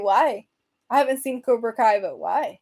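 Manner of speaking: normal talking voice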